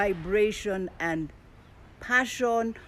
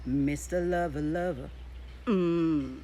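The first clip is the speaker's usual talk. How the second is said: [name]'s sexy baritone; 2 semitones lower, slower than usual. Shown as a demonstration. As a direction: croon it like a seductive singer. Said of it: "I think I'll use [name]'s".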